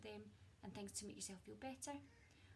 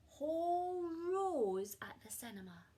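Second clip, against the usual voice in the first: dramatic emphasis; 8 semitones higher, 12 dB louder, slower than usual. A narrator's reading. Dramatic story beat.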